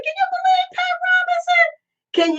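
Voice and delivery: high, incredulous squeal of disbelief; can you believe it, voice shooting way up. Real, not acted. Asking viewers about Pat Robinson, in shocked high disbelief.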